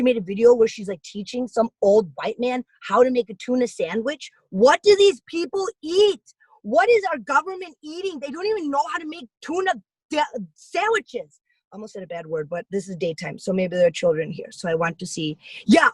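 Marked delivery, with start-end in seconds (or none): 4.5-11.4 high-pitched disbelief at what they eat; mock outrage. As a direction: act scandalized at their food. Mock outrage at government food.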